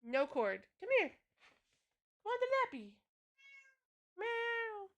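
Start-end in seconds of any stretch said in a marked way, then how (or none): 0.8-1.1 much higher coaxing call; high cat call. Real, not acted. Streamer calling her cat over in a high voice. 2.2-4.9 high, soft coaxing pet talk. Real, not acted. Streamer luring her cat onto her lap, high and soft.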